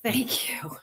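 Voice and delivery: hushed thank you to God; the speaker thanking the Lord in the parking lot, whispered. Retold or imitated. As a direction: breathe out thanks in awe. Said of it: "raising my hands up to the Lord and just said".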